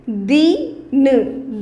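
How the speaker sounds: दीन, ई को खींचकर ज़ोर से; long ee, stressed. Shown as a demonstration. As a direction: hold the ee in दीन long and firm. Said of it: "उसको थोड़ा सा ज़ोर की बोलेंगे".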